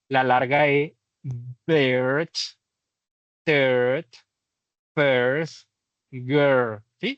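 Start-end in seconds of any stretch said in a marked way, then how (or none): 1.7-6.8 put-on "bird, third, first, girl" with the vowel drawn out a little; teacher modelling the long vowel, exaggerated; stretched long E. Shown as a demonstration. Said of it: "Extienden un poquito la E".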